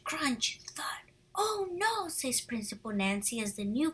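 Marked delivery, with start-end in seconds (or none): 1.4-2.1 dismayed oh no. A narrator's reading. Principal dismayed in story.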